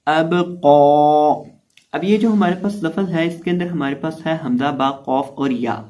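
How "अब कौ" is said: अब कौ with bounced ब and full, sustained कौ; lengthened heavy qaf. Shown as a demonstration. Teacher modelling recitation, slow अब कौ with held heavy vowel. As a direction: release ब with a bounce, sustain कौ thick and even. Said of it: "कॉफ को पुर भी करेंगे".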